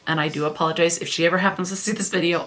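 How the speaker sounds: ordinary delivery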